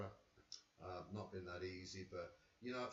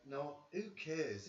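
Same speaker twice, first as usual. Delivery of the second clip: normal talking voice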